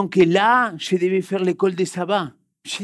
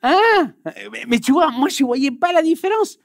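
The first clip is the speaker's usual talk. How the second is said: high, loud disbelieving "Hein?"; baffled surprise; 9 semitones higher, 5 dB louder than usual. Retold or imitated. A student baffled by the class's laughter, loud and high. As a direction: sound completely lost, pitch jumping up.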